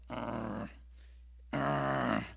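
exasperated wordless growl; frustrated growl. Acted. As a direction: grumble it out as if held back.

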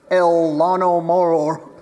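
slow, loud, hammed-up Spanish accent; mock Spanish accent. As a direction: draw it out in playful fake Spanish. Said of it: "as they call it in El Mexico".

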